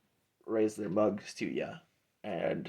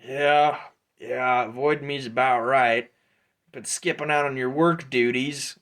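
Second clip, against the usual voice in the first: louder ribbing mercenary voice; teasing Drudge; 3 semitones higher, 6 dB louder than usual. Acted. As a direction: needle him about skipping work, louder.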